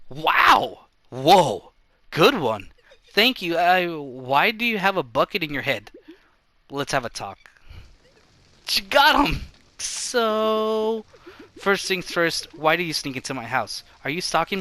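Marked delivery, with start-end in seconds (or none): 0.1-2.7 loud, delighted shouts; excited cheering. Acted. Cheering a good hit. 8.7-11.0 high, loud put-on cheer; excited cheer. Cheering a catch.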